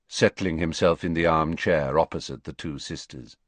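ordinary delivery

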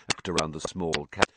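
ordinary delivery, nothing marked

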